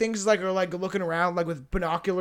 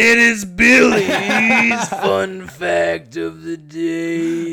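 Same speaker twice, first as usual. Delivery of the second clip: put-on loud, slow jingle in a Swedish accent; 8 dB louder, much slower than usual. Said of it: "I like the Italian voice".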